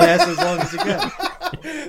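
slow, high, laughing words. Real, not acted. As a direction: drag it out through laughter. Laughing at the game show.

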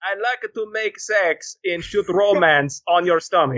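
put-on slow, camp Austrian accent; slow hammy Austrian voice; Bruno accent. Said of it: "That sounds like Bruno".

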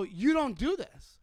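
loud, slow, emphatic; stern scolding; firm telling-off. Retold or imitated. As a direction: lay it down slowly and hard. Telling off the heckler.

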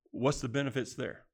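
ordinary talk

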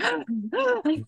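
a high, startled gasp; mock gasp. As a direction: gasp in delighted shock. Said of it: "I was like".

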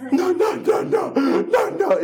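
high, squealing no no no, laughing; whiny little girl refusing. Retold or imitated. La hija se niega a probar. (niña chillona que se niega entre risas)

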